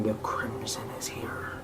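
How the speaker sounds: slow, breathy whisper from a burning bush; creepy slow whisper; eerie whisper. A narrator's reading. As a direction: hiss it out slowly like a ghostly voice. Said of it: "a face whispering".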